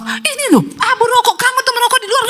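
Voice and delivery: high-pitched wifely scolding; nagging wife. Retold or imitated. Wife to smoking husband over cigarette ash, high-pitched nagging. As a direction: rattle off a shrill-high complaint.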